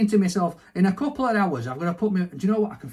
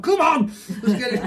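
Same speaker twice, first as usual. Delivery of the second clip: loud high hammed-up shout; mock battle cry; 8 semitones higher, 7 dB louder than usual. Player hyping up a mock fight, loud shout. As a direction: roar it like a fighter, joking.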